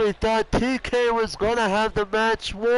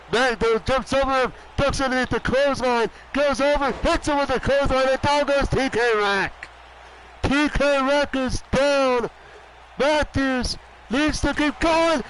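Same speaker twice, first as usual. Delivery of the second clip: ordinary talk, nothing marked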